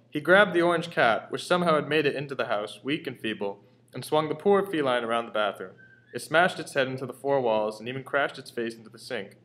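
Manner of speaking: ordinary delivery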